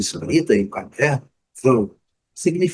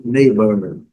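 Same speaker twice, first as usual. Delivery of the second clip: neighbor in an American accent, "neba"; plain American "neighbor"; 5 dB louder than usual. Shown as a demonstration. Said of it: "e no inglês americano". (neighbor com sotaque americano)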